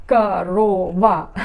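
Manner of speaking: "корова" slowly, in three separate syllables; three-syllable demo. Shown as a demonstration. Teacher showing how long Russian baby words are, "корова" slowly syllable by syllable. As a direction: stretch out each syllable of "корова". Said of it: "has three syllables".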